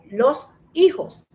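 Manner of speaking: "los hijos" slowly split in two; a clear break between "los" and "hijos"; overseparated "los ... hijos"; unlinked words. Shown as a demonstration. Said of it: "Nadie dice los hijos".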